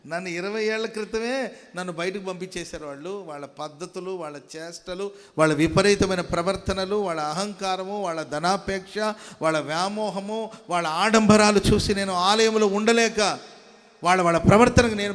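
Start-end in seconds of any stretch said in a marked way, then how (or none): 5.4-15.1 loud, driving sermon delivery; preaching at full force. Acted. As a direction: preach it out to the whole congregation. Preacher lists the church's sins. (బిగ్గరగా ఉద్రేకంతో ప్రసంగం)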